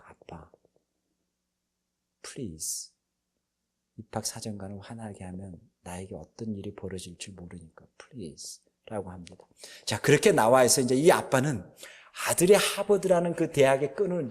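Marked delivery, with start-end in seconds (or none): 0.1-9.1 much softer, lower hushed plea; pleading behind his back. Retold or imitated. Son quietly begging embarrassed father to stop, hushed plea. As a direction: beg dad quietly so the officer won't hear. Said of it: "이런 이야기를 뒤에서 합니다".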